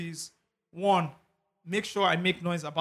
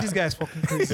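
laughing quote. Retold or imitated. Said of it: "I said".

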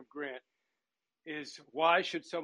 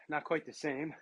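normal talking voice

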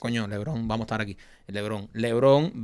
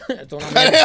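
laughing; 8 semitones higher, 6 dB louder than usual. Real, not acted. Laughing verdict on the game.

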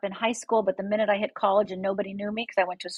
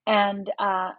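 normal talking voice

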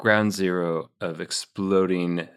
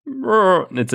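animal sound; 13 semitones higher, 5 dB louder than usual. Pretend. Doing the underwater animal sound.

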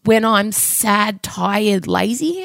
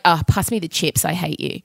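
sulky retort; 5 semitones lower, 4 dB quieter than usual. Retold or imitated. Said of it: "I'm like".

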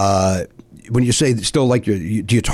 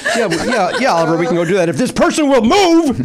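impatient mock politeness; 10 semitones higher than usual. Reenacting Target road rage.

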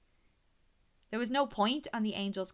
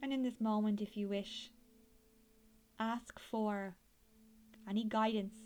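airy breathy mystical healer. Acted. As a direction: gently guide them inward like a healer. Leads a guided meditation.

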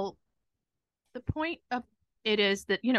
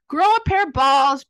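much louder, higher, emphatic retelling; retold blunt pep talk; 4 semitones higher, 10 dB louder than usual. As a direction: belt it out, imitating a tough-love friend.

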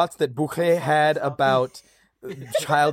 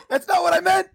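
high-pitched protest; 13 semitones higher, 3 dB louder than usual. Real, not acted. Panicked denial after insult.

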